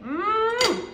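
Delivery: high, rising "mmm" of pleasure; high yummy moan; delighted tasting hum. Real, not acted. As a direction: savor a delicious bite with a long hum. Tasting the pizza bake.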